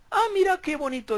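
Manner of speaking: high sarcastic ah, mira qué bonito; mock delight, with an ironic edge; sarcastic mock son. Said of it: "le dice el Hijo".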